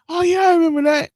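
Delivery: high, loud chorus of relatives remembering; mock eager agreement. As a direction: pipe up like the whole family recalling it. Voicing whole family agreeing in story.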